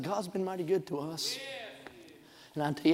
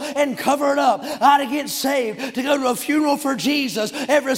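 high, loud revival preaching; shouted, exultant pulpit cadence; full-force preaching; 7 semitones higher, 8 dB louder than usual. Real, not acted. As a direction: preach it like a rousing revival, driving every phrase.

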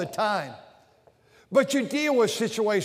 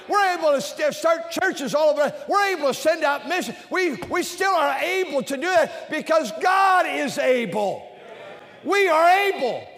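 preaching shout; 5 semitones higher than usual. Real, not acted. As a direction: drive it home to the crowd.

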